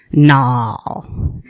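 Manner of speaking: drawn-out southern no; Kentucky drawn-out no. Shown as a demonstration. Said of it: "it goes like this".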